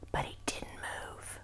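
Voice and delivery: breathy suspense whisper. A narrator's reading. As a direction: hush the line, barely voiced.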